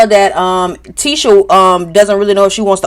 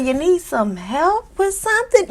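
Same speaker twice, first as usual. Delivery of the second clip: sweet soft voice; 8 semitones higher, 9 dB quieter than usual. Retold or imitated. Kimmy quoted offering help.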